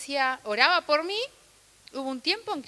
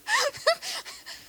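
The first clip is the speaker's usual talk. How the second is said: a high giggle at her own story; amused high laugh at herself; high-pitched laugh. Real, not acted. Laughs at her grandmother's question.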